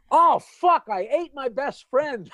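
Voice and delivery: high, loud laughing shout of dismay; horrified realization. Acted. Clam realizing he ate his friend, laughing shout. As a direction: blurt it out in mock horror, laughing.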